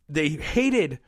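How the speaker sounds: ordinary talk, nothing marked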